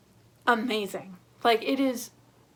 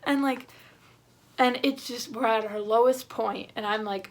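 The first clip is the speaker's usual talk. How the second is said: ordinary talk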